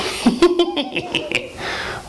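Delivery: very high, witchy giggle; sinister cackle. Acted. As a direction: cackle squeakily, pleased with the scheme. Sinister old neighbour cackles.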